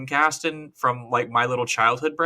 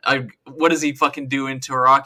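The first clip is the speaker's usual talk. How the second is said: ordinary delivery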